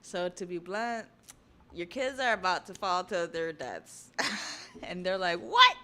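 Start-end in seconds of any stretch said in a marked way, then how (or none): 0.0-4.1 loud, emphatic villain announcement; blunt announcement. Acted. Villain telling parents their kids will fall, loud blunt announcement. 5.4-5.8 very high, loud mock shriek; shocked outburst. Parents hearing their kids are about to fall, shocked high shriek.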